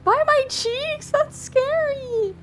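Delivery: very high, drawn-out playful squeal; mock-scared squeal. Streamer reacting to cheek-pinching threat, mock-scared squeal. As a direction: squeal slowly in play fright at the idea.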